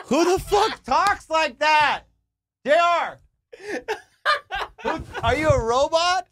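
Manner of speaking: high, loud, slow and cracking with laughter; laughing disbelief. Real, not acted. Reacting to the girl's odd line, laughing high and loud. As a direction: laugh out the outraged question. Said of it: "laughing disbelief".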